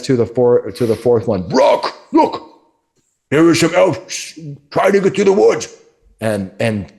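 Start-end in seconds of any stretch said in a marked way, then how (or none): 1.5-5.7 much higher, alarmed ogre voice. Acted. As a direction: point out the elves urgently. Chester alerts Brock.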